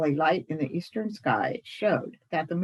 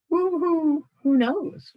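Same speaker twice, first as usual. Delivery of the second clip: high, louder hooted who; hooting owl; 11 semitones higher, 5 dB louder, slower than usual. Acted. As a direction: let the whos come out as owl hoots. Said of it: "commented the little fellow".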